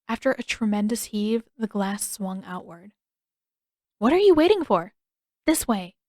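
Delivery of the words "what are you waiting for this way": loud, high urging; urgent loud call. A narrator's reading. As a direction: hurry them through. Urging from window.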